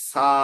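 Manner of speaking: সা with a dental hissed s, "saa"; correct pronunciation. Shown as a demonstration. Teacher answers own question, correct pronunciation. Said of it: "স এর উচ্চারণ হবে".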